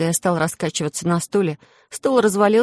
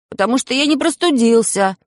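higher shouted insistence; 6 semitones higher, 4 dB louder than usual. A narrator's reading. Boy insists to his family, higher shout. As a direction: cry it out again, stress on не. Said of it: "опять крикнул я".

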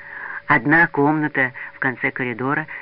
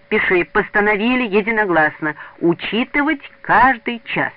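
high, loud, measured dictation; emphatic boy dictating a resolution; 7 semitones higher, 5 dB louder than usual. A narrator's reading. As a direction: spell out each phrase for the note-taker. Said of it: "диктовал Васёк".

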